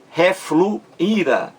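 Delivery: "Refluíra" slowly split as re-flu, then íra; syllable breakdown. Shown as a demonstration. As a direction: break "Refluíra" before the stressed í. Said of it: "Vamos ver".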